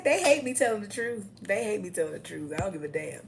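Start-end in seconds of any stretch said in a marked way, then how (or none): 0.0-1.3 higher and louder gloat. Acted. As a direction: sing it out to the haters. Defiant boast to haters.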